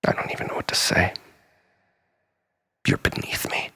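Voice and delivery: soft, cold whisper; whispering scorn at Lisa; contemptuous whisper. Acted. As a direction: breathe out the contempt.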